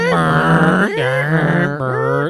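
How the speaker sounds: imitated motor grinding through gears; long loud trilled machine whirr; crazy mechanical noise. Host imitating the car seat going up and down, mechanical whirring. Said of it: "sounded like an absolutely crazy mechanical".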